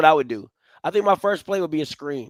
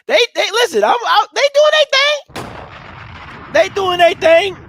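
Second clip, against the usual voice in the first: much higher and louder, hyped; 12 semitones higher, 7 dB louder than usual. Real, not acted. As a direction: shout their praise, all fired up. Hyped praise for the Canadians.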